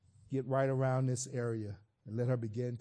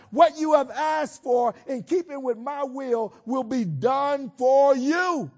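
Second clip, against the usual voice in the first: high, loud sermon voicing God; preacher speaking as God to anxious listeners, loud and high; preaching at full force; 9 semitones higher, 7 dB louder than usual. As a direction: proclaim it as God's own words.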